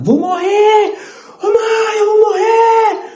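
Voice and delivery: grito agudo e alto de pânico; grito desesperado. Retold or imitated. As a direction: gritar que vai morrer, em pânico. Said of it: "comecei a gritar desesperado". (filho gritando desesperado para a mãe)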